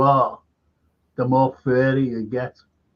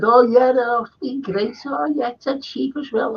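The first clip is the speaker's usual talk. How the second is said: much higher mimicked girls' voices; group of girls; 9 semitones higher than usual. Girls tell the supposed waiter about their drinks, high mimicked voices. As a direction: imitate girls chiming in, pitched high. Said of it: "And they said".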